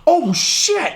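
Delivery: high startled exclamation; mock shocked. Watching the coaches fight, high shocked exclamation. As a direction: react like you just saw a fight start. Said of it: "I'm like".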